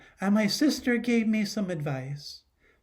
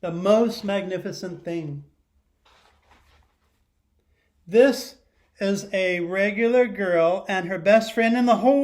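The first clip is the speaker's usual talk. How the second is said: storybook read-aloud; 9 dB louder than usual. Acted. Teacher reading a picture book to her class, lively read-aloud. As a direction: read it big so the whole class hears.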